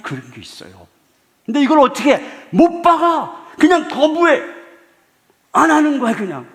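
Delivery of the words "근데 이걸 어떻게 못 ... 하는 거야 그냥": loud, higher proclaiming voice; triumphant preacher's shout; forceful preaching. Acted. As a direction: declare it to the congregation.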